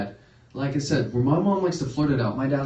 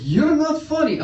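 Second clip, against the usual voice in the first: high, loud mock-exasperated repeat; insistent dad; 5 dB louder, slower than usual. Dad insisting, repeats himself louder.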